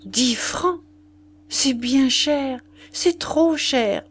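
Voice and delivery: high, drawn-out protest; dismayed. A narrator's reading. Morin protesting the vicar's price, dismayed. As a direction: complain slowly, pitch climbing in dismay. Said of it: "consterné".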